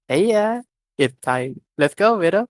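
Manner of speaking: calling across. A narrator's reading. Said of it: "spoke up from across the guild hall".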